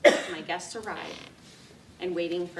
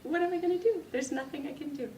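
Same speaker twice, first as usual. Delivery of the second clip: high, then fading, at a loss; tearful helplessness; 9 semitones higher than usual. Retold or imitated. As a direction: wonder what to do without tissues. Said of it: "it was like".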